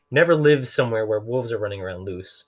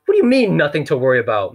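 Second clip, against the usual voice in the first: high, loud disbelieving outburst; can't believe what he's hearing; incredulous; 5 semitones higher, 6 dB louder than usual. Acted. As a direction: banker outraged at the villain's calm. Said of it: "asked incredulously".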